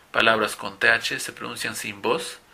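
usual speaking voice

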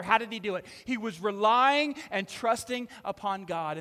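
loud preaching voice; full-force preaching. Real, not acted. Pastor preaching Peter's story loud to the congregation. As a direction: preach it out to the room.

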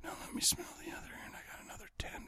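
fast creepy whisper; rushed breathy whisper in the ear; right in your ear. Pretend. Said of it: "You finish that up with".

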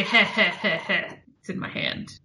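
sneering villain snicker, mostly voiceless; villain laugh. Acted. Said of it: "do you like my villain laugh".

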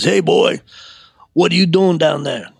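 acted-out challenge from a looming man; confronting big man. Big store man catches a boy stuffing his pockets, confronting.

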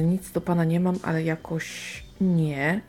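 ordinary delivery

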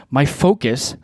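ordinary talk, nothing marked

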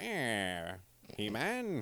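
low, mumbled "Eh" into He-Man; a lightly voiced nasal mumble; impression of his voice. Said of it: "I kind of, like, go like".

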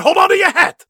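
high, loud, manic cry; Professor Craftsman. Acted. Quoting Mel Brooks's professor to Bo, hammed-up yell. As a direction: shout it like a crazed showman. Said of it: "as Professor Craftsman".

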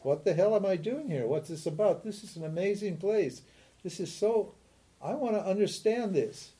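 high, soft, acted amazement; curious wonder. Teacher voicing seekers who want to know, in curious wonder. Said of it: "They're just curious and they want to know".

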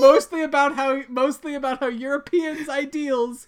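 high, amused summing-up; fighting laughter. Real, not acted. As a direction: try to finish the sentence while cracking up. Host sums up amid laughter.

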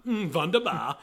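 put-on high, loud German accent. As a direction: say it like a southern belle faking German accent. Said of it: "doing a German accent in his Southern Belle voice".

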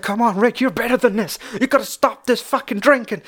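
angry at himself. Retold or imitated. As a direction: berate yourself in the mirror. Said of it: "he's angry at himself".

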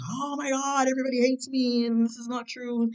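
high, panicky mock meltdown; losing your mind. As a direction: play someone who thinks everybody hates them. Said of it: "do you just lose your mind".

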